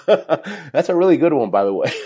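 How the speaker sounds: laughing loudly through the words. Real, not acted. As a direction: crack up while saying it. Laughing about the desperation prayer.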